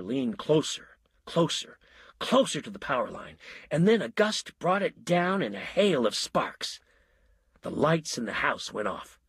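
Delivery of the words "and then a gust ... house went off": plain reading voice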